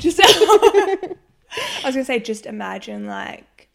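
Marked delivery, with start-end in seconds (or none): 0.0-1.1 breaking into laughter; giggling through the words; laughing. Real, not acted. Bursts out laughing.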